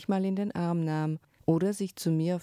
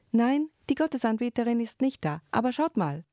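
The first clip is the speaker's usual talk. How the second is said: put-on high, loud and fast, a boy calling out a find; excited discovery; 7 semitones higher, 3 dB louder, faster than usual. A narrator's reading. Scene: Yu calling his friends over to the eggs, excited. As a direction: blurt it out, thrilled by the find.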